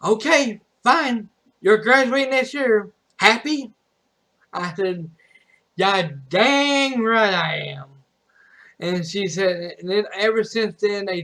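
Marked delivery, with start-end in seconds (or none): 0.0-3.7 high, loud, irritated concession; exasperated giving in. Retold or imitated. Mom gives in to her son's demand, exasperated. 5.8-7.9 slow, loud, drawn-out defiance; emphatic triumph. Retold or imitated. Son answers mom about graduating, emphatic triumph.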